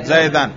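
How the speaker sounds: "زیدن" ending clearly in -an; nasb ending. Shown as a demonstration. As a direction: say "زیدن" with a final "an" sound. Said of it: "نصب پہ تلفظ کریں". ("زیدن" نصب کے ساتھ، آخر میں "اَن")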